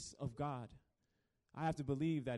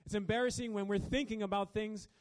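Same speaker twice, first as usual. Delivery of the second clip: higher, louder preaching, pressing forward; preaching at full force; 6 semitones higher, 6 dB louder than usual. Acted. Preacher driving home the point to the congregation, fervent. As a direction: build the point with rising fervor.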